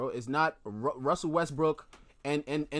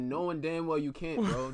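usual speaking voice

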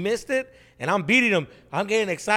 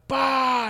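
kick sound effect; 9 semitones higher, 5 dB louder than usual. Retold or imitated.

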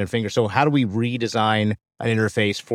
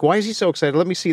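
curious excitement; 6 semitones higher, faster than usual. Retold or imitated. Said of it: "other people around the table is like".

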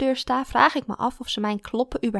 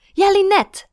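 Ja, Linette with a firm falling tune; Ja, Linette said falling, decided; certain confirmation; 11 semitones higher, 10 dB louder than usual. Acted. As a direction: settle on the name, high and sure. Teacher confirms name.

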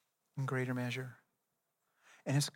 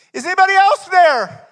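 desperate cry for help; 18 dB louder than usual. Acted. Climber dangling from a cliff cries to God, very high panicked shout. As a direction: scream it up to heaven, hanging on for life. Climber calls out after silence.

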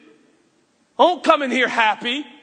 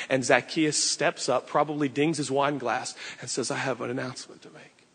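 lower, softer narration drawing listeners in; hushed storytelling; 9 semitones lower, 6 dB quieter than usual. Acted. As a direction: paint the scene quietly and intimately. Low intimate storytelling of the scene.

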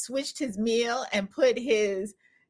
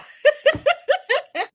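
loud high laugh; 5 dB louder than usual. Real, not acted. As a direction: burst of high-pitched laughter. Laughing at retold kid video.

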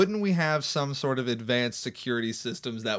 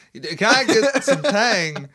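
Christopher Walken impression; 7 semitones higher, 6 dB louder, much slower than usual. Walken bit continues.

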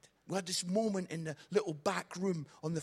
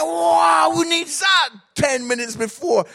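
high, very loud comic shout; outraged shouting; 12 semitones higher, 20 dB louder than usual. Acted. Nervous comic reacting to dad before the show, outraged shouting. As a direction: yell it in exasperated disbelief.

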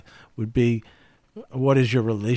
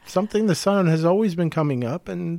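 higher-pitched, airy impression of someone; bad imitation of Brenda; 6 semitones higher than usual. Asked what a colleague would say about time, bad higher-pitched imitation. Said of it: "my bad imitation of Brenda".

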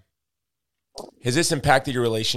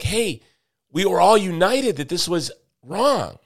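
higher-pitched appeal for agreement; earnest protesting appeal; 7 semitones higher than usual. Acted. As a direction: plead that everyone is on the same side.

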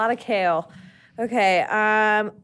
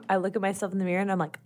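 ordinary talk, nothing marked

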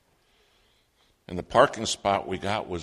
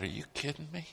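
higher, quieter disbelieving thought; incredulous; 8 semitones higher, 6 dB quieter than usual. Retold or imitated. Boy privately doubting his mom could run Sunday school, incredulous. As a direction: think it aloud in mild disbelief.

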